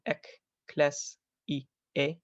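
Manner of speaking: "ek, kles, i, e" said syllable by syllable; split into syllables. Shown as a demonstration. Teacher splitting up a Latin word, syllable by syllable. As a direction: separate "ek", "kles", "i", "e" with short breaks. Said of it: "that gets split up into".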